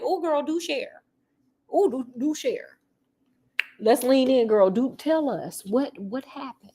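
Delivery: soft, slow nosy invitation; mock eager. Coaxing the couple to spill the tea, soft and slow. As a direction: lean in like juicy gossip. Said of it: "one thing that I'm like".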